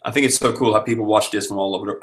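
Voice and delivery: ordinary delivery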